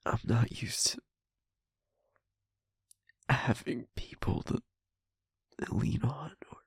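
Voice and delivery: very soft, low, half-whispered; subdued confiding. Acted. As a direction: confide haltingly, drained after crying, with long pauses. Spent, confiding after crying.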